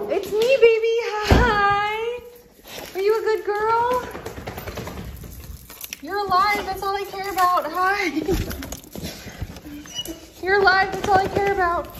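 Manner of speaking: gasping high reunion voice. Real, not acted. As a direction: squeal with relief at the dog. Reunion with her dog.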